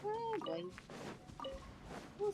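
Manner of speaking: ordinary talk, nothing marked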